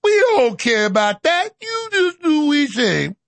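slow, hammed-up dismissive caricature; mock bossy leader. Leaders brushing off warnings, mock bossy. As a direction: draw it out, rising high, imperious.

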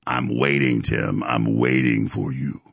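a villain waiting for his rival; low, slow menacing taunt. Acted. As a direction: drop deep and slow, stalking.

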